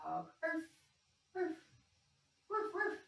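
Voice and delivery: high, yappy little dog barks; puppy arfs. Pretend. Teacher barking like a dog in the yoga pose. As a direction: bark like a happy puppy. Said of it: "up into your dog".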